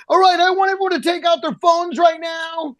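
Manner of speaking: high, shouted crowd command; mock stage shout. Comedian ordering the audience to follow him, high mock shout. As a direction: bark orders at the crowd like a pushy comic. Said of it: "He's like".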